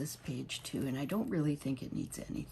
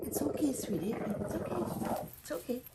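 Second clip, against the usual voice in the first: soothing pet talk; 10 semitones higher than usual. Real, not acted. Owner soothing her whining dog in his cone, high tender cooing. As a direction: reassure the pup gently, way up high.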